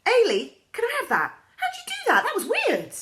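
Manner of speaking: high, loud and laughing; excited classmates. A narrator's reading. Classmate amazed by the donut, laughing and begging for it. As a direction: squeal with amazed delight.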